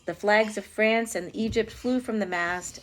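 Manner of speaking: ordinary delivery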